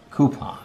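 "coupon" with no y sound after the C; coupon without Q. Shown as a demonstration. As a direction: say "coupon" starting like coo, as a correction. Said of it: "There's no Q in".

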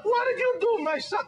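high, loud Italian immigrant dad; dad's thick Italian accent. Retold or imitated. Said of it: "thick Italian accent".

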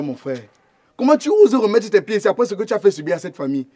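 normal talking voice

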